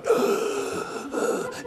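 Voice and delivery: heavy, noisy puffing breaths; laboured mock wheeze; smoker's wheezy breathing. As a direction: mimic a smoker huffing for air. Plumber who smells like cigarettes breathing.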